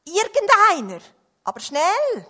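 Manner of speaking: loud, high urgent shout; pressing storybook holler; calling for help. A narrator's reading. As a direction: call out to anyone who can hear. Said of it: "rief er".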